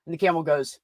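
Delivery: ordinary talk, nothing marked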